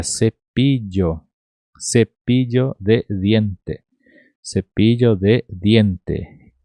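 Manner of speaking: "cepillo de diente" sounded out slowly, word by word; Spanish pronunciation demo. Shown as a demonstration. Teacher showing Portuguese speakers the Spanish double L, slow pronunciation demo. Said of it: "a nossa pronúncia seria".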